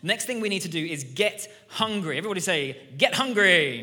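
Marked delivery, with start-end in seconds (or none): 3.0-3.8 loud, higher call to the room; rallying call. Shown as a demonstration. Preacher rousing the congregation to repeat, rallying shout.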